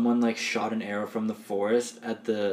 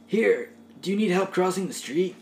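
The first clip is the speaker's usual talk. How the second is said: nice surfer guy; 9 semitones higher, 4 dB louder than usual. Acted. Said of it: "play someone like that who wasn't an idiot".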